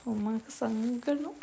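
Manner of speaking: usual speaking voice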